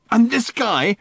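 high, loud, wound-up rant; ranting Ford. A narrator's reading. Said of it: "ranted Ford".

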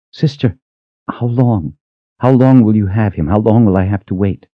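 high, urgent questions; anxious pleading. Acted. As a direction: call after her, pleading to know. Pleading to departing sister.

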